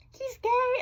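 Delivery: high, slow, drawn-out mock voice; mocking falsetto. Mocking people labelling him, high and slow. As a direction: drag it out like a gossip. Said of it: "I hate when people are like".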